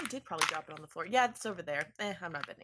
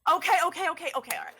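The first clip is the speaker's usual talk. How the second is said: flustered; 10 semitones higher, 8 dB louder, much faster than usual. Acted. Nervous flustered stalling.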